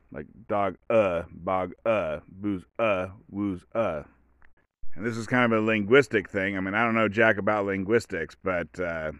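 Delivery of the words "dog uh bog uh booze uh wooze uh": "dog uh, bog uh", each uh set apart; final uh split off. Shown as a demonstration. Showing both words end in uh, endings separated. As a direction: break "booze uh, wooze uh" before every uh. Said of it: "they both end in the sound uh".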